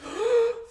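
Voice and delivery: sharp high gasp; mock panicked gasp. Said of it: "you're like".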